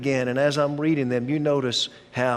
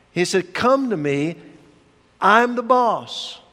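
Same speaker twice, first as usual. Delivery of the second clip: forceful preaching; 4 semitones higher than usual. Acted. Preacher declaring Jesus the master to the congregation, forceful. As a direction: preach it like a proclamation of authority.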